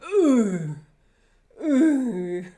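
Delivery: loud grunt from the stomach; punched grunt. Shown as a demonstration. Teacher grunts like being hit in the stomach. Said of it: "someone hits you in the stomach".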